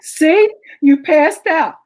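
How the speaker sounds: loud, slow gotcha; triumphant gotcha. Retold or imitated. Wife to fallen husband, loud triumphant gotcha. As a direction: nail him with it, loud and slow.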